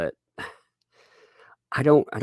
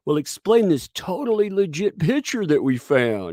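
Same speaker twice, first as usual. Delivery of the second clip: mocking media; 9 semitones higher, 5 dB louder than usual. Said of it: "the media is like".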